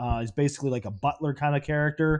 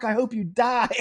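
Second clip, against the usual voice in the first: yelling; 10 semitones higher than usual. Retold or imitated. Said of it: "yelling at him".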